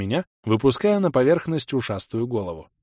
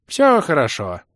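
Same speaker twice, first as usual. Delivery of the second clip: put-on high, loud old creature voice; 4 semitones higher, 5 dB louder than usual. A narrator's reading. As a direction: soothe like a cheery old creature. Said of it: "успокоил меня водяной старикан".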